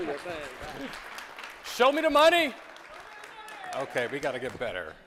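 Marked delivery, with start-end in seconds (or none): 1.8-2.5 loud, high call for the crowd; movie catchphrase. Pretend. Speaker to audience, shouting the catchphrase. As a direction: belt it out like the film line.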